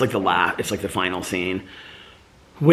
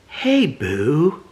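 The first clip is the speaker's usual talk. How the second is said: tender greeting; 7 semitones higher, 3 dB louder than usual. Retold or imitated. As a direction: greet him tenderly, like a shy friend. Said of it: "Like that".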